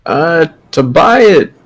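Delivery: put-on slow, frail old woman haggling; slow old lady. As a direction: savour the price like an old seller.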